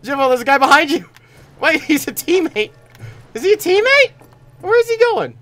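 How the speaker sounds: loud high urgent calls; urgent shouting. Real, not acted. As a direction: shout the warning. Warning teammate, confusion.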